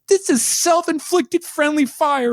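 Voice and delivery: high, slow, indignant whine; Annie's upset voice. Retold or imitated. Podcaster mimics a pepper-sprayed student defending herself, high upset voice. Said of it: "Allison Brie has that whole, like, upset voice".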